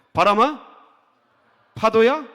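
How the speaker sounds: loud, high command to the storm; booming rebuke to the sea; commanding call. Shown as a demonstration. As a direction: order the wind to stop. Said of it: "제가 "바람아" 할게, 잠잠하라 하세요".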